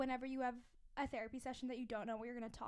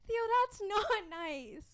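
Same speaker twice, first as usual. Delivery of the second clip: slow, higher, louder scolding; 7 semitones higher, 5 dB louder, slower than usual. Real, not acted. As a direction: tell the dog off. Scolding the dog.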